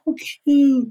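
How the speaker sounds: put-on high cooing voice to a baby; baby talk. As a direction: coo it sweetly like at a baby. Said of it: "That's how we talk to babies".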